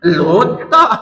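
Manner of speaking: a laugh over the words; amused laughing reply. Real, not acted. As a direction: laugh as you say it.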